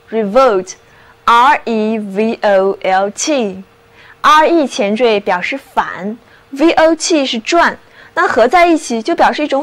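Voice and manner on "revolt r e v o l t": slow spelled-out word. Shown as a demonstration. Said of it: "它就是revolt".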